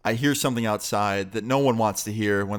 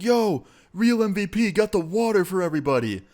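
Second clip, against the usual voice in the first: higher, excited shout-out; pumped party greeting; hyped-up friend; 9 semitones higher than usual. Retold or imitated. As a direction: hype your buddy up. Anthony hyping him at party.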